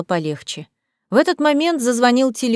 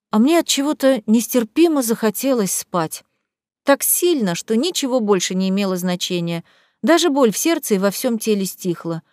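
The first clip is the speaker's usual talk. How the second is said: ordinary delivery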